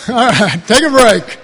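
loud, cheerful call to the room. Real, not acted. Preacher calling the congregation to take a break, loud. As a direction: announce the break to everyone.

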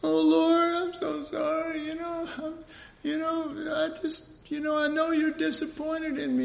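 high, pleading mock grovel; groveling apology. Sinner grovels to the Lord, high hammed-up pleading. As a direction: beg forgiveness in a hammed-up high voice. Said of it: "it's like".

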